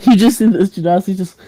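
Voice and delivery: ordinary talk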